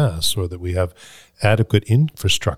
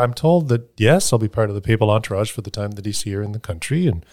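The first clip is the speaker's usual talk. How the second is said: ordinary talk, nothing marked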